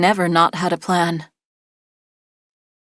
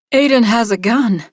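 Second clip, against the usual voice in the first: ordinary delivery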